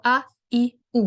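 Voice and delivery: "آ، ای، او" each started with a clean glottal stop; short separate hamza sounds on "آ، ای، او"; hamza demonstration. Shown as a demonstration. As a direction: pop open each vowel of "آ، ای، او" separately. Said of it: "اس طرح ادا ہوگا".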